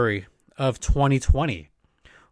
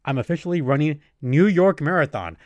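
usual speaking voice

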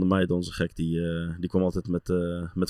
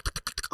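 whispered tapping noise; 10 dB quieter, faster than usual. Retold or imitated. Imitating boot studs.

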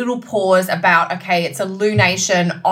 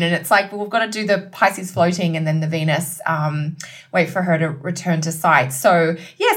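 usual speaking voice

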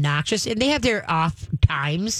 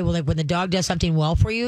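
normal talking voice

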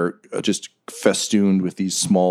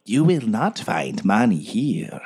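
slow, whispery warning; eerie guardian; 3 semitones higher, slower than usual. Acted. Unseen guardian warns the treasure seekers, slow eerie whisper. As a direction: whisper it slowly as a strange guardian.

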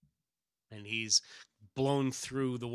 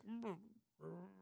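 muffled hum; 7 semitones higher, 16 dB quieter than usual. Acted. Hero muffled inside jaws.

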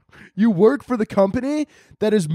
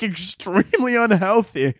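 normal talking voice

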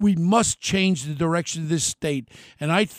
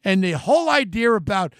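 ordinary talk

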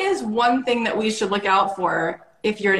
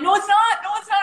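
high-pitched playful denial, retold; mock protest; 11 semitones higher, 4 dB louder, faster than usual. Wife retelling her denial to husband, high mock protest. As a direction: squeak out a mock-indignant no. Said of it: "I'm like".

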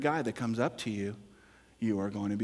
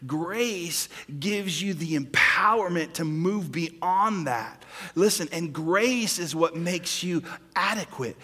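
loud, driving sermon with stretched key words; forceful preaching; 6 semitones higher, 8 dB louder than usual. Real, not acted. Pastor telling the congregation what grace does, forceful preaching.

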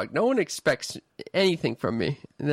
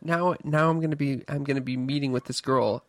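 usual speaking voice

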